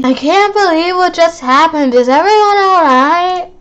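alarmed. Acted. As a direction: rush to check everyone. Kid shaken after scare.

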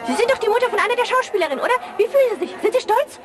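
high, fast mock interviewer voice; mock TV interviewer asking a mother if she is proud; mock reporter. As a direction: play the eager TV reporter, quick and high.

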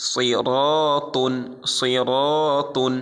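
chanted tajweed demo of সিরাতুন; heavy R recitation. Shown as a demonstration. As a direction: draw out সিরাতুন, R full and heavy. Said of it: "মোটা করে".

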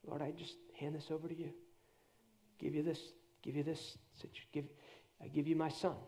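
low, hushed prayer of trust; soft surrendering prayer. Acted. Preacher models handing worries to God, soft surrendering prayer.

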